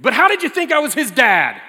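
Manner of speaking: high and loud, teasing; loud mock challenge; playful accusing. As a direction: needle him with a grin. Teasing Jeff about the dad mixup.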